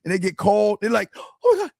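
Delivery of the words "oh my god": much higher, squealing mock contestant; excited contestant. Mocking a thrilled game show contestant, much higher. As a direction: shriek like you just got picked on a game show.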